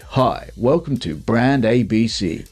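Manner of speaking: slow, measured announcer-style delivery; voiceover artist. Shown as a demonstration. Co-host demonstrating a really cool voiceover, slow announcer voice. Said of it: "doing a voiceover like".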